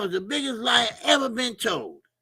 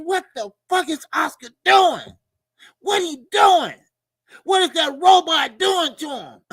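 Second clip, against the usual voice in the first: higher, louder shocked yelling; retold outburst; 8 semitones higher, 7 dB louder than usual. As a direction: shout it like you can't believe your eyes.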